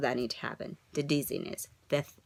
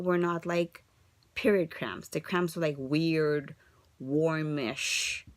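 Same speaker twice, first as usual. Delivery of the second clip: ordinary delivery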